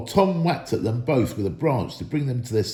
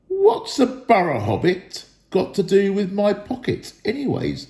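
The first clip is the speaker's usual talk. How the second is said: suspicious troll; 7 semitones higher than usual. A narrator's reading. William voiced by narrator.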